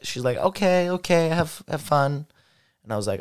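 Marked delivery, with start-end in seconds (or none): 0.4-2.2 higher-pitched quoted okay; imitated woman's reply. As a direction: lightly voice her higher, hesitant go-ahead.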